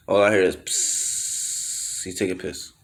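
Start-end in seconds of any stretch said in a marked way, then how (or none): 0.6-2.0 soft voiceless hiss for peeing; imitated pee hiss. Uncle taking a piss beside the shower, quiet hiss.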